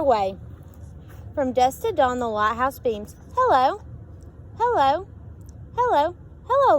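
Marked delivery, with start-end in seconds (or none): 3.3-6.8 sing-song hello. A narrator's reading. Lighthouse calling out refrain.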